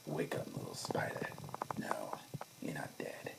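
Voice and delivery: hushed, menacing near-whisper; soft Green Goblin whisper. Retold or imitated. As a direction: purr it softly, like a villain savouring the moment. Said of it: "soft like that".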